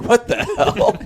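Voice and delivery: very high, laughing disbelief; retold shock cracking into a laugh; incredulous outburst. Host retelling reaction to a mixed-up story, incredulous and laughing.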